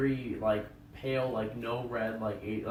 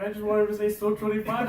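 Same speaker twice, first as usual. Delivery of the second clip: higher, slightly louder mimic; mimicking friend; 7 semitones higher, 4 dB louder than usual. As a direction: quote him innocently. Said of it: "And he's like".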